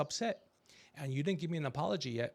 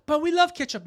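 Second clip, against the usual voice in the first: put-on high, loud whiny kid voice; kids protesting; 13 semitones higher, 12 dB louder than usual. Kids begging their dad for ketchup, whiny and loud. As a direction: whine it like a stubborn child.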